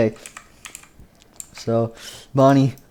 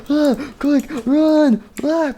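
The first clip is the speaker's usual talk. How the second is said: very high squealed alarm; acted panic; much slower than usual. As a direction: squeal in mock terror, urging escape. Everyone flees in story.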